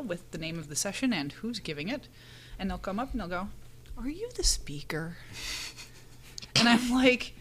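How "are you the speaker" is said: hushed, breathy mock question, slowed; mock whisper. Mimicking an attendee who asks the obvious, in a mock whisper. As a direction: whisper it like a silly secret.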